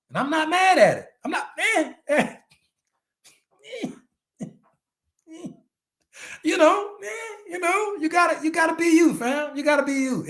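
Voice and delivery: high, playful teasing, with a laugh in it; telling Steelers fans to be themselves, high and playful. Real, not acted.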